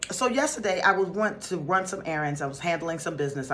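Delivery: ordinary delivery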